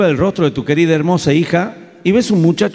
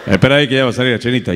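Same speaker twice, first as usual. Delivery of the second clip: quick, lower brush-off; rushed dismissive father-in-law; 5 semitones lower, much faster than usual. Retold or imitated. Father-in-law brushing off the suitor, fast and low. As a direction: rattle it off lower and quick like a busy dad.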